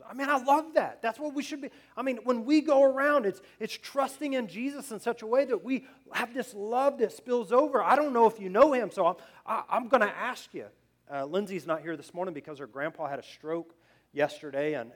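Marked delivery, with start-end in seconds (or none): 0.0-10.7 high, loud, impassioned preacher; fervent preaching. Real, not acted. Preacher urging congregation to let love spill over, fervent. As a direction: preach it out with raised pitch and volume.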